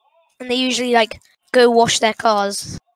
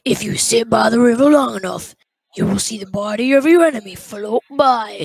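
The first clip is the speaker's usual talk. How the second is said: Pig Patch; 5 dB louder than usual. Acted. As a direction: play a cartoon animatronic, swelling on key words. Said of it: "I do the best Pig Patch impression".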